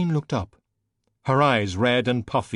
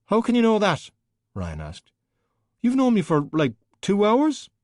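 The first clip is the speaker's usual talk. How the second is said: indignant protest; 11 semitones higher, 4 dB louder than usual. Acted. Ryan protests, high indignant voice.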